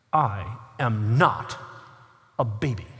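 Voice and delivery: put-on indignant little girl. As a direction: put the waiter in his place with dignity. Five-year-old daughter offended.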